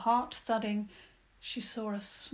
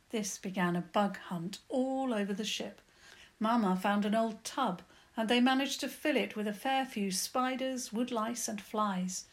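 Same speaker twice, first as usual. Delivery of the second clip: usual speaking voice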